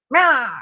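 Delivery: short, high, odd little meow; weird cat meow. Retold or imitated. Owner demonstrating her cat's learned weird meow, high and short. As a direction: copy the strange meow the son taught the cat. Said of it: "a cat that meows like this".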